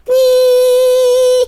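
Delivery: high, loud whining buzz; fly buzzing sound. Retold or imitated. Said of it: "this light fly buzzing sound".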